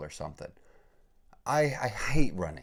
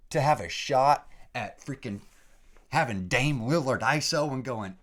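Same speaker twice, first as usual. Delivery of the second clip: higher and louder, talking himself into a frenzy; growing excitement; 5 semitones higher, 7 dB louder than usual. Real, not acted. Podcaster to listeners, dreaming of the NBA, rising excitement. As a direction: voice climbing as the NBA dream takes over. Fantasizing about NBA, building hype.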